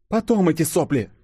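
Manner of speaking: high, impatient brush-off; dismissive snort. A narrator's reading. Girl brushing off her friend's gushing, high dismissive snort. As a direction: cut the sentiment short impatiently. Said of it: "фыркнула ёжик".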